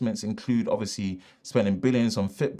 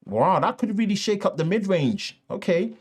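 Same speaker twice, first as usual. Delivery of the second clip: surprised; 8 semitones higher than usual. Real, not acted. Surprised reaction.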